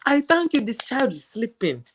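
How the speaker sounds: put-on happy West African accent. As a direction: thank Jesus in a West African accent, overjoyed the child sleeps. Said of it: "it's when I'm happy".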